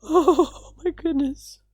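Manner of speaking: slow, high tearful sigh. Real, not acted. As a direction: catch your breath after crying. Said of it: "I'm crying right now".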